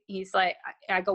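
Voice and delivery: ordinary talk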